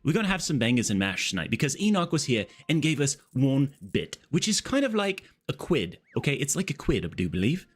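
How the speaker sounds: chatty put-on British voice; British accent. Streamer thanking a giver for bangers money, British accent. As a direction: lilt it like a jolly Brit. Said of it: "do the British accent".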